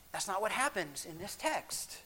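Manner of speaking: ordinary delivery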